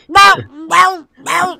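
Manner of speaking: very high, loud yelping meow; shrill barking. Retold or imitated. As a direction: yelp it sky-high like a frantic dog. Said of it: "And Tallulah's like".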